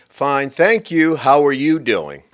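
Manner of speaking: deliberate, emphatic mock politeness; old-fashioned full reply. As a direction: take time over every word of the polite answer. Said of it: "See, we used to be".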